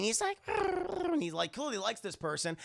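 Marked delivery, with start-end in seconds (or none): 0.5-1.1 very high little critter grumble; creature grumble. Retold or imitated. Fizzgig grumbles happily at Kira, very high creature grumble. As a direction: grumble contentedly like a pet. Furball purrs at her.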